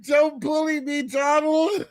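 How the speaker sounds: high, whiny pleading voice; whiny Maduro impression. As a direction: plead like a bullied kid. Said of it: "Oh my God".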